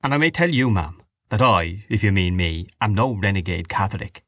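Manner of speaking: usual speaking voice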